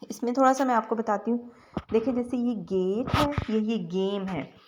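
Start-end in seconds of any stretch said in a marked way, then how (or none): none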